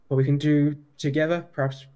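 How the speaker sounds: ordinary talk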